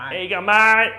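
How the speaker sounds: high, loud parroting of the greeting. Retold or imitated. Mimicking the bloke's greeting back, loud and high. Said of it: "And he's like".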